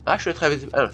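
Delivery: ordinary talk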